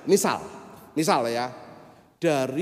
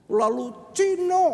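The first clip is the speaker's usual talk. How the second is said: angry insult; 6 dB louder, slower than usual. Retold or imitated. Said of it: "marah dia".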